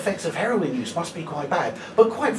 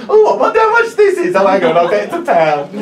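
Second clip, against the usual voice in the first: put-on drunken shopper; 10 dB louder than usual. Drunk customer wondering aloud in Poundland, loud mock shopper voice. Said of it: "She says the thing that everyone says in Poundland".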